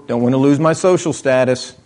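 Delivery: ordinary delivery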